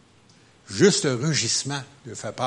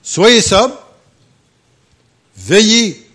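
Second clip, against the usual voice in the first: higher and louder, proclaiming the verse; preacher proclaims the command to his church, loudly; loud scripture reading; 6 semitones higher, 11 dB louder, much slower than usual. Real, not acted. Reading scripture at full force.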